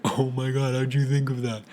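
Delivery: lightly acted impressed audience member; mock amazement. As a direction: play an audience marveling at the joke, lightly. Acting out an amazed audience reaction.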